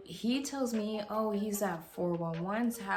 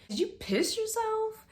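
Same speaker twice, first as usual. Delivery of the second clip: shocked question; 14 semitones higher than usual. Retold or imitated. Seeing his wet pants, she asks in high disbelief. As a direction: pitch flies way up in disbelief.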